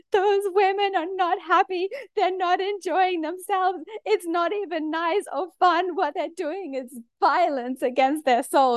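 high, teary pleading; mock sob it out, high; tearful. Retelling crying to her boyfriend, high and teary. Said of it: "I'm like".

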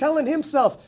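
ordinary delivery

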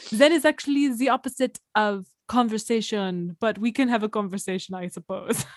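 put-on French accent, badly faked. French Zen neighbor answering a fan, bad French accent. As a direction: imitate a French speaker, hammy and unconvincing. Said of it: "can't do a fucking French accent".

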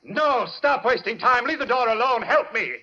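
urgent shouting. Acted. Doctor shouts orders.

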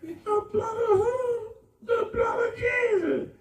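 high, slow, crying praise shout; hammed-up Holy Ghost wail; mock church shouting. Mimicking the praising woman again.

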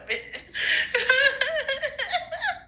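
long, high-pitched giggle fit; high giggling laugh. Real, not acted. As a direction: break into a helpless, high giggle. High, giggling fit while tripping.

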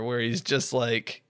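usual speaking voice

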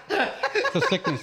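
high, slow, hammed-up take on "It's a sickness"; Daniel Glover impression. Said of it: "You know that commercial when he goes".

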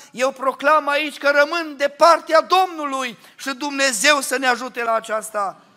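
rally the church from the pulpit; full-force preaching. Acted. Preacher exhorting the congregation.

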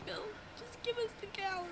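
soft, high, sing-song pestering; whiny teasing demand. Pretend. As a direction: pester him like a child. Repeated teasing demand to Bill.

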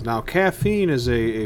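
ordinary talk, nothing marked

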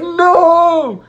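long, high, drawn-out wail of dismay; retold dramatic cry. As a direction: cry it out loud and drag the vowel. Retold dismay at dropped phone.

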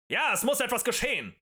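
roused men calling back; shouted agreement. A narrator's reading. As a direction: shout assent to the speaker. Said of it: "schrien einige Männer".

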